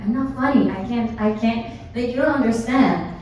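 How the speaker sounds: ordinary talk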